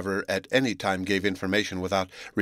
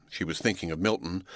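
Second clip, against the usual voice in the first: ordinary delivery, nothing marked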